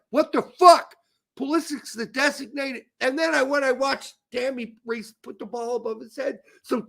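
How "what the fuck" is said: loud, high outburst; outraged. Retold or imitated. Retelling his outburst at the TV, loud and high. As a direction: blurt it out in disbelief. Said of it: "I'm like".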